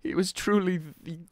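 high, loud, choked-up tribute; hammed-up grieving memorial; halting, overcome pretend mourner; mock eulogy. Mock eulogy for lost bucket.

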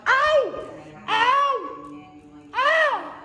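very high squeaky fake ow cries; hammed-up squeaky ouch; mock yelps. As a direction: yelp in a tiny squeaky voice.

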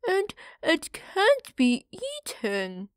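disappointed it isn't food; greedy piglet. Acted. Parlo teasing Park about his brick house, slow and high. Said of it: "added Parlo".

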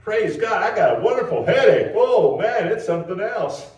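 high, loud hammed-up rejoicing; mock ecstatic praise. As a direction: gush over the headache like it's a gift. Mock joy over headache.

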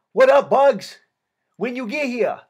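put-on high, happy shout in a Vietnamese accent; yelled out. A narrator's reading. K nine greeting his friend from county jail, yelled out with Vietnamese accent. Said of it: "He yelled out".

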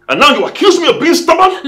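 high, loud indignant question; outraged. Acted. Husband accused of being stubborn, outraged shout. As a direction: fling the accusation back.

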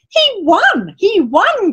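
high-pitched triumphant cry; ringing cheer. A narrator's reading. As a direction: announce the victory like an excited crowd. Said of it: "came ringing".

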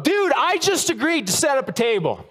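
sermon story, overwhelmed volunteer exasperated; exasperated outburst. Retold or imitated. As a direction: blurt out the helpless excuse. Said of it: "I wanted to scream out".

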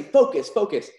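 a bold, assured magician's command; confident command. Shown as a demonstration. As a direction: sell it with total confidence. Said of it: "that's where the vocal intonation comes in".

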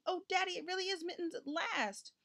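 put-on very high, delighted child voice; joyful exclamation. A narrator's reading. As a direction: exclaim in happy relief, pitched well up. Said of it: "shouted Richard joyfully".

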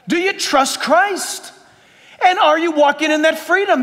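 ordinary delivery

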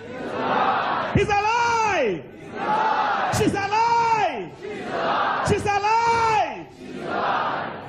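very high, slow, loud cry of joy; jubilant shout. Retold or imitated. Preacher shouting for joy at the miracle. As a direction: shout it in triumph.

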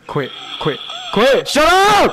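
panicked rising yell; scared horror game streamer. Real, not acted. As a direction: yell in fright to make it stop.

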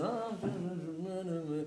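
slow, soft hesitant ahs; fake-innocent uh uh; embarrassed stammer. Man caught outdoors stammering, slow and soft. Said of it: "הוא פתאום כזה".

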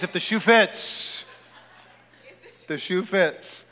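normal talking voice

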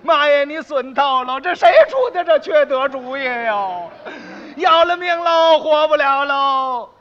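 loud, slow hammed-up wailing cry; wailing lament. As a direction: wail for mother, drawn out and loud. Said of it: "哥儿仨妯娌仨哭声没完了". (夸张地拖长哭腔嚎啕)

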